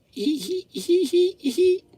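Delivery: high teasing hee-hee giggle; high-pitched hee-hee cackle; mocking giggle. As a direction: giggle like a smug kid. Kid laughing at him.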